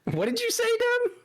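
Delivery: high-pitched disbelieving question; shocked squeak. Real, not acted. Shocked at the remark.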